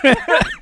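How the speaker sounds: sudden high laugh; burst of laughter. Real, not acted. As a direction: break out laughing. Teasing about film stalking.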